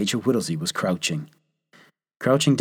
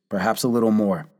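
usual speaking voice

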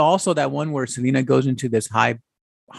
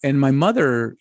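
ordinary talk, nothing marked

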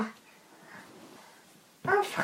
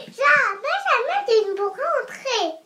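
defiant little pig; 6 semitones higher than usual. Acted. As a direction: shout it back like a brave piglet. Pig refusing the wolf.

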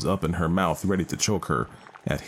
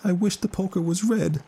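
grieving mother voice; 14 semitones higher than usual. Acted. Mother's thought.

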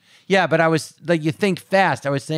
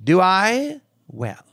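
imitating aunt and grandmother; 4 semitones higher, much slower than usual. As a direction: stretch the question up high, then let it fall. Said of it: "that's how my grandmother would talk".

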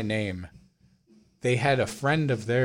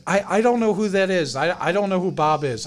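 higher, louder stammered protest; retold flustered protest; 6 semitones higher, 5 dB louder than usual. Bewildered customer answering a yelling stranger, retold flustered protest. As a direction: re-enact protesting to someone yelling at you.

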